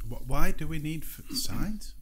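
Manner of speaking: rising into a breathy disbelieving whisper; can't believe what he's hearing; incredulous. Real, not acted. Incredulous at needing signs.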